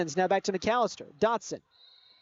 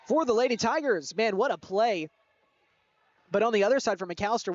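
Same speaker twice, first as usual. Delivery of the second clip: ordinary talk, nothing marked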